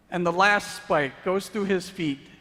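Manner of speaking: preaching at full force. Acted. Preacher to congregation, full force. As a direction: hammer each blow home.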